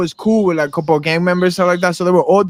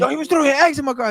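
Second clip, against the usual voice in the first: fast, high protest; complaining man; 9 semitones higher, faster than usual. Retold or imitated. The chaser explains to gang members, fast high complaint. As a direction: rush out the excuse.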